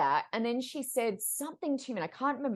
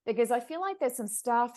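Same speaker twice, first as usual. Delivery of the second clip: normal talking voice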